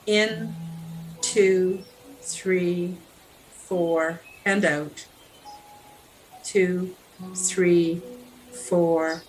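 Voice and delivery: slow, evenly spaced meditation count; guided breathing count. Real, not acted. Meditation guide paces a listener's breathing in and out, slow measured count. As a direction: draw out each count, pausing calmly between them.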